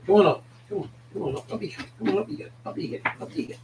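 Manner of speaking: soft, high coaxing; coaxing a dog. Real, not acted. Host lifting his wee dog up to show, coaxing softly. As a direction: urge the pet up in a small, soft voice.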